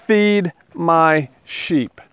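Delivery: very slow, deliberate command; slow solemn command. Acted. Jesus commanding Peter a third time, very slow. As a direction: lay each word down slowly.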